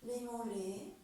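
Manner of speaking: slow, calm yoga nidra guidance with long pauses; guided relaxation. Acted. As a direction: lead them through the body part by part, unhurried. (voix de relaxation guidée, lente et posée)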